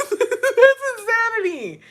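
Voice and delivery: high, slow laughing outburst; disbelieving laugh. Real, not acted. At the stabbed mattress story, high laughing disbelief. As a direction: laugh at the madness of it.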